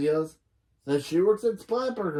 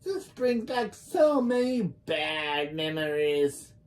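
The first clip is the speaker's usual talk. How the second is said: slow, drawn-out put-on character voice; put-on sitcom boss, lingering on each complaint; Missus Wong; much slower than usual. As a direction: drag the words out as a hammed-up grump. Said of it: "Missus Wong says".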